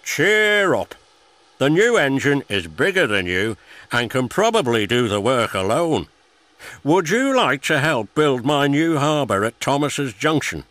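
a jolly boss cheering up his engine; hearty boss. A narrator's reading. Fat Controller encourages Percy.

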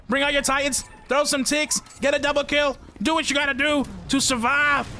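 high, fired-up commands; hype shouting. Real, not acted. Gamer urging teammate on mid-fight, high excited shouts. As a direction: pump the teammate up.

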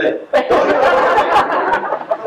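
put-on high, slow Scottish accent dissolving into laughter; laughing Scottish accent. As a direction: squeak it out through helpless giggles. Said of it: "she just said in her Scottish accent".

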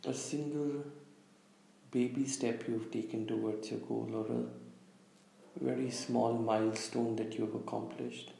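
calm meditation guide, long pauses between phrases; unhurried, slightly hushed meditation delivery; guided visualization. Real, not acted. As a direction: lead listeners gently inward, leaving space after each instruction. Leads a guided visualization exercise.

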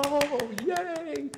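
very high, drawn-out squeal of joy; acted gushing "yay", held long and high; delighted parent cheer. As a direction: cheer for baby's first word in a sky-high voice. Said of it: "you just reinforce, with a positive reinforcement".

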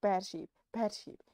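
scolding. Acted. Scolding the sheep.

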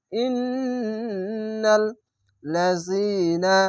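slow, long-drawn chanted syllables; tajweed recitation. Shown as a demonstration. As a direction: stretch every sound in recitation. Recites the phrase.

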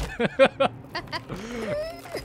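high-pitched giggle; high laugh. Real, not acted. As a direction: squeal with laughter. Laughing at the straining noise.

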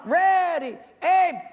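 high, loud drawn-out military command; shouted command. Acted. Commander ordering the firing squad to shoot, loud shout.

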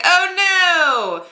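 high, loud cartoon dismay; mock alarm. Reviewer voicing everyone seeing Sanan turn, high mock alarm. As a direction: cry it out in exaggerated panic. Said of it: "everyone's like".

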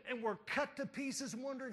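normal talking voice

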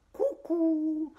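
high teasing "Cucù"; mocking cuckoo. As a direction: taunt with a sing-song "Cucù". Pinocchio mocking.

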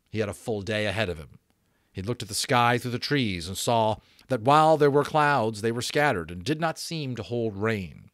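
plain reading voice

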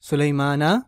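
सुलेमाना with a rising open final आ; teaching demo. Shown as a demonstration. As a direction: end सुलेमाना on a bright "ना". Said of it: "तो हालत नसब में". (सुलेमाना, आख़िर में "ना")

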